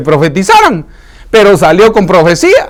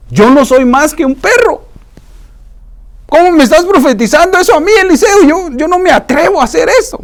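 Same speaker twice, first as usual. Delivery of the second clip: much higher, protesting disbelief; pleading Hazael; 12 semitones higher than usual. Acted. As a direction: rise higher and higher in shocked denial. Preacher voices Hazael protesting.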